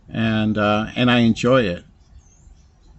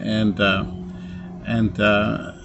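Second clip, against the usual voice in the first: ordinary talk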